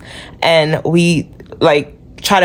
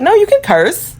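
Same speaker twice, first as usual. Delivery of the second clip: much higher-pitched mimicking voice; mock quoting; 12 semitones higher than usual. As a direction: mimic their permissive advice in a high mock voice. Mimics someone permitting cursing.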